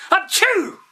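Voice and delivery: a huge high explosive sneeze; giant sneeze. A narrator's reading. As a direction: sneeze like a giant blowing a man away. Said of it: "the giant sneezed".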